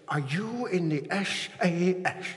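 slow, drawn-out imitation of a preacher; Richard Parnaby. Quoting a preacher's phrase to the congregation, in impression. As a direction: stretch it out as the old preacher's catchphrase. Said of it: "And he talks like this".

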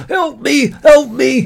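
loud high cries for help; shouting for help. A narrator's reading. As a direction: yell desperately. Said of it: "he's like".

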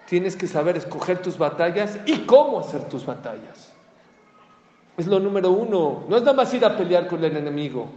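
fiery preacher climax; preaching at full force. Acted.

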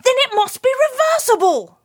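plain reading voice

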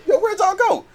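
high, loud questioning; high angry voice. Retold or imitated. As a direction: yell it high and demanding. Angry friend retold.

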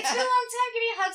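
high, loud imitation of a friend's mom; effusive reunion voice, acted out; gushing greeting. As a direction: demand a hug with delight. Acts friend's mom greeting her.

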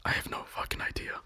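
very soft whispered reply; whispering. Pretend. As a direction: whisper it, keeping up the bit. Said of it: "Why are we whispering".

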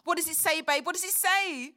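desperate to hear the result; loud eager pleading question; anxious urgency. Acted. Waiting for results to be read.

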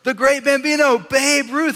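high, emphatic mock proclamation; acted hero worship. Movie kids schooling the new boy, mock awe. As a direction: reel off titles with hammed-up awe, pitch way up. Kids reciting hero's nicknames.

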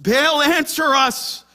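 loud, high desperate call to a god; imitated frantic prayer shout; pleading cry. Prophets crying out to Baal.

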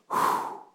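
soft, breath-only whew; airy, voiceless sigh of peace; long exhale of relief. Acted. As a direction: let all the tension out in one breath. Acting out sudden relief and peace.

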